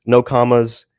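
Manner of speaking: ordinary delivery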